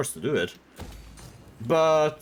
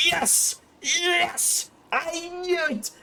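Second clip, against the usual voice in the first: much higher giddy cheer; mock excited; slower than usual. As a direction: squeal with joy like a kid. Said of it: "he's like".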